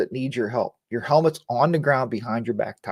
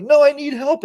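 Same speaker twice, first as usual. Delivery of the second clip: high-pitched mock cry for help; raised, lightly acted plea; 11 semitones higher than usual. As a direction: imitate a stranded rider calling out. Acts stranded biker again.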